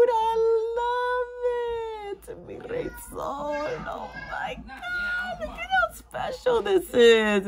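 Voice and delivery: high, choked-up crying through the words; tearful joy. Real, not acted. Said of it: "you're gonna make me cry".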